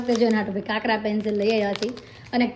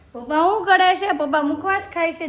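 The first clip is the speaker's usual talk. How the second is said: excited echo; 6 semitones higher than usual. Real, not acted. Mother echoing her toddler's question about papa.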